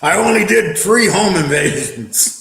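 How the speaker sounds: slow, disbelieving words through laughter; laughing mockery. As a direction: repeat it back, laughing at how absurd it is. Mocking the guest's admission.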